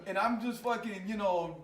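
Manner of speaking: ordinary talk, nothing marked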